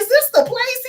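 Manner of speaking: high, tentative mock-nervous voice; squeaky worried question to the stranger. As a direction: pitch it way up like a scared city girl. Retelling her nervous question.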